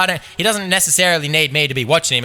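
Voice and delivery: ordinary talk, nothing marked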